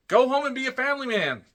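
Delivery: high, loud pleading shout; exasperated yell. Pretend. Fan telling the injured wrestler to retire, exasperated yell. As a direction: yell it like scolding a stubborn friend.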